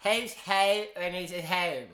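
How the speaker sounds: Who's who said slowly, laughing, with a mock posh lilt; attempted Australian. Learner tries the Aussie accent, sounding like Margaret Thatcher. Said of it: "sounding like Margaret Thatcher".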